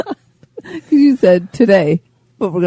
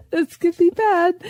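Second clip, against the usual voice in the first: giggling; 9 semitones higher than usual. Real, not acted. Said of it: "got the giggles".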